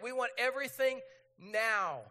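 acted-out impatience, landing hard on "now"; hammed-up want-it-now insistence; demanding. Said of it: "We're like".

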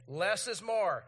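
emphatic, measured recital; leading group recitation. Real, not acted. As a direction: get the whole room chanting along. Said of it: "Say it again with me".

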